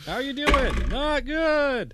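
very high, silly put-on voice; mock hurt-knight exchange. Player riffs on the battered knight, silly high voice. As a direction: squeak it out in a comic high register.

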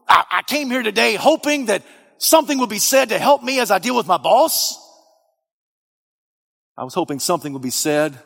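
high, loud fervent preaching. Acted. Preacher to congregation, fervent and loud. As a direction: build it up and thunder at the congregation.

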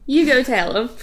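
mock Southern God sending someone off; Southern accent. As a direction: send them off with a Southern lilt. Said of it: "He said it with a Southern accent".

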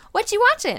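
put-on high, childlike daughter's voice; little daughter voice. A mother imitating her daughter asking for the potty in a high childlike voice. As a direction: pipe up like a little girl.

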